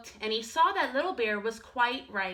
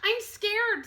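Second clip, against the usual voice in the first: higher small cub voice; a tiny scared cub, pitched up; little bear cub; 12 semitones higher, 3 dB louder than usual. Acted. Little Bear tells Big Bear he is scared, high small voice.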